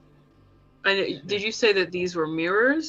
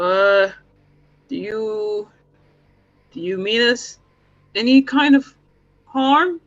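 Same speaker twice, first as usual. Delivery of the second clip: loud, raised questioning call; 3 semitones higher, 9 dB louder than usual. Acted. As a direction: call out haltingly to an unseen voice. Calling to a disembodied voice.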